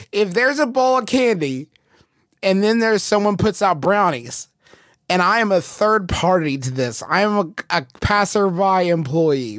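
ordinary talk

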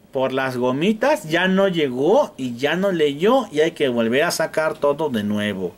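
ordinary talk

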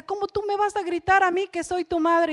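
mother scolding. Acted. Mother scolding child, acted quote.

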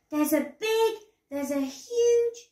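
put-on high voice of an excited child; urgent, excited. A narrator's reading. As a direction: build it up, stretching out the size. Daisy urgently reports the rhino.

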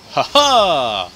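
belted triumphant ha ha; over-the-top victory laugh; loud mock villain laugh. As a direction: bellow a hammy villain cackle. Mocking enemy triumph laugh.

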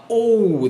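オー as a long, rounded "oh" glide; rounded British O. Shown as a demonstration. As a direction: round the lips and stretch オー. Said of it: "オーって音を丸く出すんですよね". (丸く伸ばしたイギリス式の「オー」)

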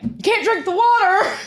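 high, loud mock grumble; grumpy stepdad. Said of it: "My stepdad's sitting over there like".

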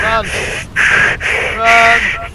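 loud high yell; shouting. Real, not acted. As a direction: yell to flee. Urging teammates.

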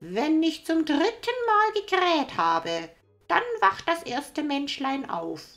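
high, loud rooster. Acted. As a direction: crow the words proudly. Rooster speaks.

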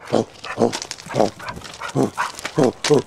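ordinary delivery

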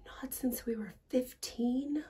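hushed, slow, breathy question; whispering. Acted. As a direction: whisper it in disbelief. Shocked aside, whispered.

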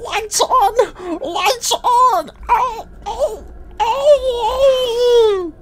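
very high, loud pained cries; shrieking daycare attendant. Acted. Attendant shrieks as lights turn off, crying out. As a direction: wail in a hammed-up panic.

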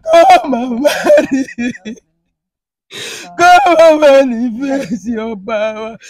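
loud, drawn-out wailing prayer; crying. Real, not acted. Woman begs the Lord to come down, wailing. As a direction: cry out to God at full voice. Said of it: "You have started again".

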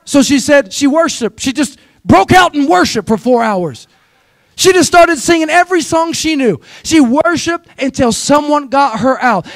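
lively preacher cadence; preaching. Acted. Pastor preaching to his church, rousing. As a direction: preach it with rising energy to the congregation.

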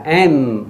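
"M" closing the lips into a nasal hum; closed-lip M. Shown as a demonstration. Teacher shows learners to close the mouth, humming "M". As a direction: end "M" with the mouth shut. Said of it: "嘴巴要合起来".

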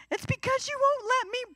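put-on high-pitched whining kid voice; whiny child. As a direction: whine like a bawling toddler denied something. Child throwing a fit.

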